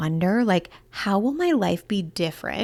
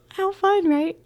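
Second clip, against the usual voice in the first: excited squeal; 12 semitones higher than usual. Real, not acted. Excited about her intention.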